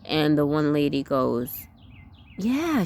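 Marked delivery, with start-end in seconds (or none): 2.4-2.8 high, joyful agreement. Retold or imitated. Homeschooling lady giving advice, joyful. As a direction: chirp it like a cheerful friend. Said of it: "she had such a joyful voice".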